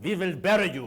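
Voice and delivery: imitated Russian accent, w turned to v; heavy Russian accent. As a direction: quote it in a mock Russian accent. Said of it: "with a very heavy Russian accent".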